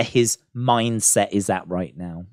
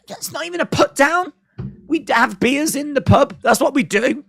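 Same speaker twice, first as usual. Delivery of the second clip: incredulous; 12 semitones higher than usual. Real, not acted. Incredulous British rant on the insult.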